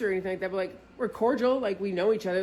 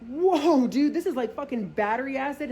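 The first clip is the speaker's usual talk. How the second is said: shocked disgust; 6 semitones higher, 4 dB louder than usual. Retold or imitated. Tasting strong drink.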